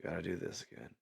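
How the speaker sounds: soft, dragging sigh of routine; tired worker mumbling under his breath; weary mumble. Retold or imitated. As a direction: mutter it like you've done this a thousand times. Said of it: "you're like".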